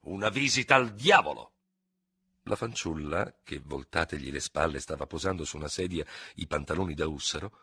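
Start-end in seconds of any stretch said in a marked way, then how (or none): 0.0-1.5 louder, higher, dismissive exclamation; incredulous, scoffing outburst; exclaiming. A narrator's reading. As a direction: throw it back as an indignant exclamation. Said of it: "esclamò".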